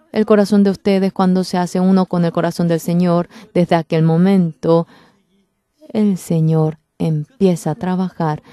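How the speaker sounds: normal talking voice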